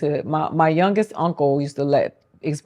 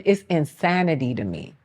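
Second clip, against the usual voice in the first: ordinary talk, nothing marked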